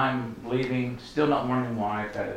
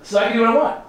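eager question; 10 semitones higher, 6 dB louder, much faster than usual. Retold or imitated. Retold eager question to the Lord.

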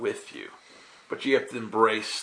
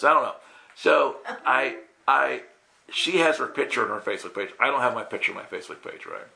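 normal talking voice

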